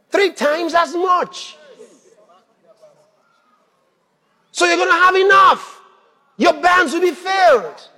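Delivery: loud, high shout of triumph. Real, not acted. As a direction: proclaim it at full voice, building to a peak. Preacher proclaiming God's promise.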